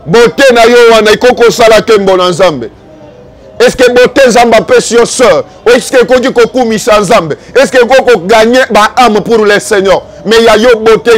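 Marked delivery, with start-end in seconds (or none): none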